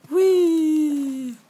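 high, loud squeal of glee; gleeful whee. Real, not acted. Gamer flying through the air, high loud gleeful squeal. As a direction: squeal like on a rollercoaster.

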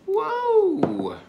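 sudden very high whoa; surprised. Real, not acted. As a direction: squeal it in surprise. Surprised reaction while tasting.

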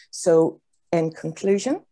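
ordinary talk

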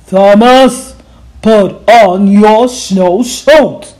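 loud, slow, stern authority voice; stern principal. Acted. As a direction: lay down the law. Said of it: "his very best principal look".

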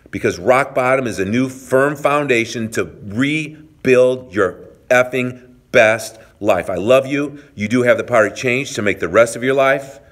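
rally the viewers not to give up; motivational pep talk. Acted.

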